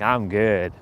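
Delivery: low, soft, smiling; smiling, genuinely good. Shown as a demonstration. Someone smiling as they say it, low contented tone. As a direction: let a contented smile into it. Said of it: "like, a smile on their face when they say".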